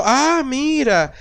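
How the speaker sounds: high, slow, stretched "Ah, mira"; delighted "Ah, mira" said very slowly and high; mock delighted ah. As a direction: imitate a partner charmed by the food. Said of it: "al principio dijo".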